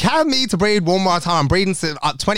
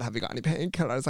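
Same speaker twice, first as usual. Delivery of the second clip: quiet; 3 semitones lower, 12 dB quieter than usual. Retold or imitated. Retold whimpering request.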